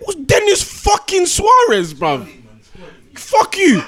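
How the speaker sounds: high, drawn-out scornful shout; exasperated fan's high-pitched rant; outraged. Real, not acted. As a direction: spit out the player's name in outrage. Outraged rant about a club signing.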